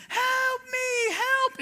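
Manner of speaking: high, pleading call for help; mock cry for help. Young woman in the street calling for help, high and frightened. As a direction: play the young lady crying out for help in the street. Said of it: "screaming from a, a young lady".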